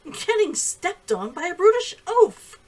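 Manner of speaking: loud, high, slow snapping retort; sharp insult at a clumsy man; furious woman insulting the man who stepped on her; angry retort. A narrator's reading. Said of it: "slapping his hand away".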